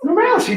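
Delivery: correcting the coworker, he says normalcy as nor-mal-uh-see; his own pronunciation. Shown as a demonstration. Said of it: "throwing a little accent in there".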